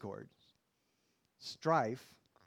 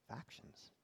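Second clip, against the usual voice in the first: whispered; 15 dB quieter than usual. Shown as a demonstration. Repeats the word, then whispers it.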